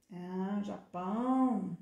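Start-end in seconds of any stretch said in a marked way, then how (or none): none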